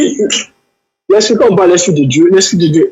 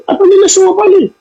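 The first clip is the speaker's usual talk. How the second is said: high, pushed protest to get the floor; pleading to speak; 3 dB louder than usual. Real, not acted. Debater cut off, begging the host to let him speak, high and urgent. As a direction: voice jumps up, insisting on a turn.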